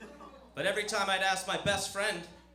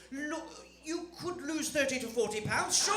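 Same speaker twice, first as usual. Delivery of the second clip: high-voiced, halting admission; reluctant British mother; 8 semitones higher than usual. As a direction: concede it gingerly as mum. Mother reluctantly admits the truth.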